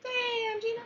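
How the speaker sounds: squeaky high Damn Gina; high-pitched catchphrase. Shown as a demonstration. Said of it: "catchphrase in high voice".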